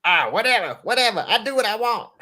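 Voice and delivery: high whiny cartoon kid, imitating; bratty cartoon boy, mocking; Cartman voice.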